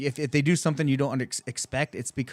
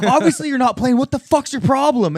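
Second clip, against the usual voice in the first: loud, high, exasperated yelling; indignant retort at full voice; outraged comeback; 12 semitones higher, 9 dB louder than usual. Retold or imitated. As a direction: re-enact shouting down an attacker. Acting out his angry comeback.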